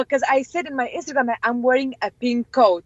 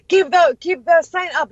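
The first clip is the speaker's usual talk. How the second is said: loud, higher mock command; retold shout; 6 semitones higher, 5 dB louder than usual. [name] shouting at boys at the march, loud order. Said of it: "I was, like, shouting so hard".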